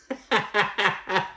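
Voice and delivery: real high-pitched laugh; a quick amused laugh. Real, not acted. Viewer laughing at Larsa's joke.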